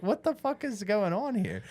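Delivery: high, incredulous inner outburst; stunned and amused at the situation; mock baffled. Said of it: "I'm like".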